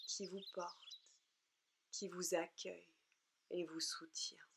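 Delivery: unhurried relaxation guidance in short, soothing phrases; guided meditation. Acted. Leads a yoga nidra relaxation.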